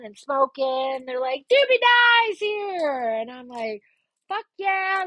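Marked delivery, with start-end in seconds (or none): 1.5-3.1 excited greeting. Retold or imitated. Friends greeting her, retold. 4.3-5.0 high-pitched party cheer; eager agreement. Retold or imitated. Her keen answer, retold.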